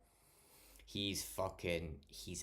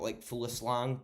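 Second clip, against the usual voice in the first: ordinary talk, nothing marked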